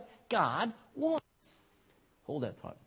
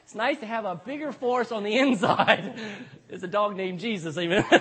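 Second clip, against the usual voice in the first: laughing through the words; real amusement breaking into a big laugh; 4 dB louder than usual. Real, not acted. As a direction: crack up at your own punch line. Cracks up at own punch line.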